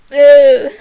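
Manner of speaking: frustrated "uh" outburst; exasperated groan. Real, not acted. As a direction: groan loudly, lost for words. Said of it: "no words".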